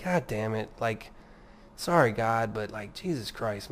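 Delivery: low, subdued sheepish swearing; apologetic. Acted. Speaker showing how to swear to God, apologetic. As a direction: mutter it softly, like owning up. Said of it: "say it apologetically, like".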